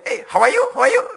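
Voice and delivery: high, loud parrot greeting; excited bird-like voice; talking parrot. Acted. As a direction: squawk English like a trained mynah. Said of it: "Beo kita bisa bahasa Inggris".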